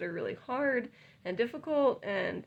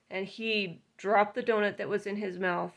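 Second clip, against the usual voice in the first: ordinary talk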